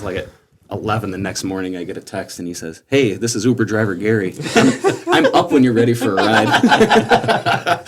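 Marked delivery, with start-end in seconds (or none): 2.9-7.8 louder, drawn-out quote breaking into laughter; laughing retelling. Retold or imitated. Groom retelling the Uber driver's text to friends, laughing. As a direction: read out the text through laughs.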